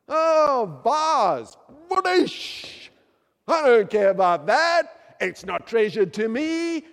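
loud, high mocking shrug at the vase; careless guest. Preacher mocking a careless guest's loud dismissal.

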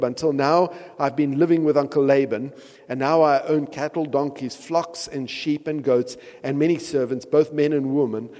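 lively Bible reading; scripture reading. Acted. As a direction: read it as a humble servant's letter. Reads Jacob's message.

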